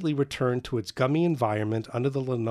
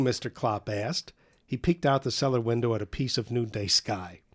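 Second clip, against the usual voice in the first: ordinary delivery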